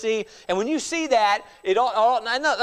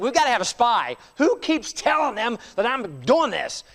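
frustrated king; 7 semitones higher, 5 dB louder than usual. Retold or imitated. Syrian king demanding to find the spy, exasperated. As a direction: play the fed-up king hunting a spy. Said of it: "He's like".

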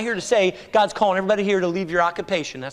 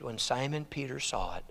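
ordinary talk, nothing marked

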